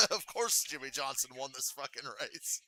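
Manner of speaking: scoff-laugh at the obvious result; exasperated laugh. Real, not acted.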